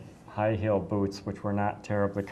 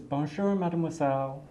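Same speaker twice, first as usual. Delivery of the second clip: cheerful French greeting; 6 semitones higher than usual. Retold or imitated. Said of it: "throws his arms up the air, and says".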